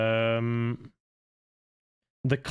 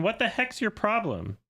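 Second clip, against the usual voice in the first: indignant; 10 semitones higher than usual. Real, not acted. Indignant at a chatter's trick question.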